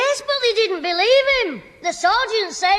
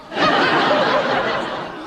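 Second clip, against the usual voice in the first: cheeky laugh; 9 semitones higher than usual. Acted. Kid laughs at his own story.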